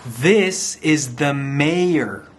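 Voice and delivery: plain reading voice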